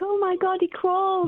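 high, amazed retold exclamation; shocked. Mum retelling baby's first crawl, shocked. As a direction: re-enact stunned delight, pitched high. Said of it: "I was just in shock".

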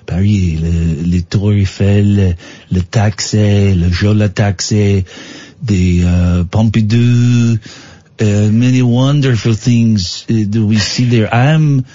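comic Parisian, dreamy about home; hammed-up, halting French accent. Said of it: "in my French accent".

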